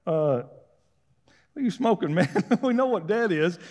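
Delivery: laughing scornfully through the words; mocking laughter. Mourners laughing Jesus to scorn, mocking. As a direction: scoff and laugh at him. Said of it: "start laughing at him like".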